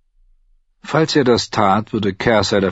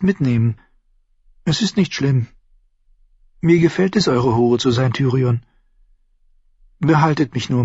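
higher, lightly acted woman's voice; submissive mistress; 6 semitones higher than usual. Shay asking Tyrion to keep her safe, higher lover's voice. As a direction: reassure your lover, pitched up.

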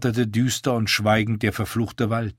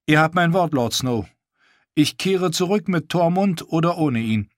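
higher, proud wildling woman; a lightly voiced proud wildling woman; Val's voice; 7 semitones higher than usual. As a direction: voice her higher and defiant.

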